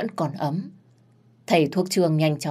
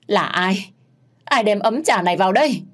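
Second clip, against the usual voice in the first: higher, louder furious demand; hissing rage; 4 semitones higher, 5 dB louder than usual. Acted. Mother demands who brought the tea, furious hiss. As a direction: spit out the question in hatred. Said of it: "rít lên đầy căm phẫn".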